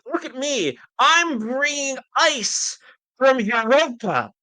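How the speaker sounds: high, drawn-out grandstanding; mock a billionaire showing off, stretching words high; showy mock boast. Host mocks the billionaire's look-at-me stunt, showy boasting.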